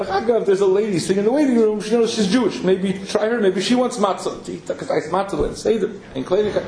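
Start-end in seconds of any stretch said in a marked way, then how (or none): 0.0-4.5 a touch higher, chatty doctor suggestion; a hint of the doctor tipping him off; lightly voiced doctor. Doctor's quote in story.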